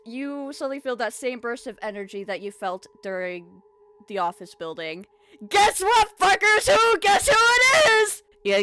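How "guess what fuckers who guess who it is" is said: high, loud, gloating shout; villain's shouted entrance. Acted. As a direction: burst in yelling at the whole party. Villain bursts in on party.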